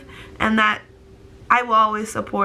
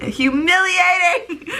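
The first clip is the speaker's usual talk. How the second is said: slow, higher, laughing while crying; laughing through tears; 9 semitones higher, slower than usual. Real, not acted. Embarrassed crying vlogger to viewers, laughing through tears. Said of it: "I'm crying".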